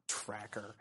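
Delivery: "Tracker" very low and hushed; muttered jab. Acted. Lecturer mimicking Boromir's lingering grudge, muttered jab. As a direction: slip the word out under the breath. Repeats the insult as an aside.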